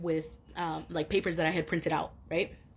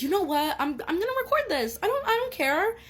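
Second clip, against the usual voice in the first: defiant resolve; 11 semitones higher, 3 dB louder than usual. Retold or imitated. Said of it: "because I'm like".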